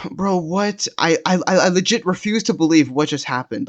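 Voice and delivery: high-pitched, stammering incredulity; stunned disbelief. Retold or imitated. Retells own disbelief after drive-off.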